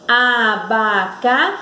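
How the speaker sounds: "Abacaxi" drawn out slowly, pause before xi; stressed syllable demo. Shown as a demonstration. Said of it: "esse impulso tá no chi".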